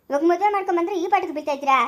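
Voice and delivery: ordinary talk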